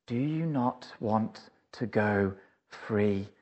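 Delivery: low, soft, slow, tender question; gentle invitation. Acted. Jesus at the cell door asking the prisoner, low, soft and slow. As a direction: ask it like an open door. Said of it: "and he asks you".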